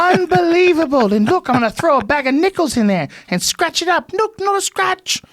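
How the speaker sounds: high-pitched English infomercial pitchman; proper English accent. Acted. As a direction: sell it excitedly in a hammed-up English accent. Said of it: "some man with a proper accent".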